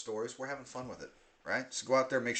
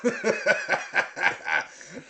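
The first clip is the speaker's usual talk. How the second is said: burst of laughter; 6 dB louder than usual. Real, not acted. Laughs at D P Cooper.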